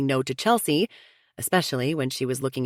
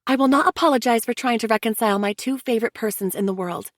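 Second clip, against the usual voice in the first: higher, earnest sister-in-law pleading for unity; Chelsea, insistent; 7 semitones higher than usual. A narrator's reading. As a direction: press them to sit together like family. Chelsea voiced, affectionate.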